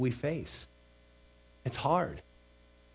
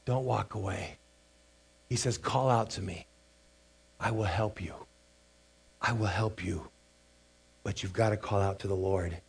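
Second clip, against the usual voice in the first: tender pleading father; 5 semitones lower, 5 dB quieter than usual. Retold or imitated. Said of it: "he's saying".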